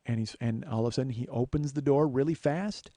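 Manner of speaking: ordinary talk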